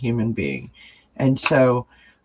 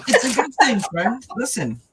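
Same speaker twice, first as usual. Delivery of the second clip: after crying; 7 semitones higher than usual. Real, not acted. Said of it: "if I start blowing my nose".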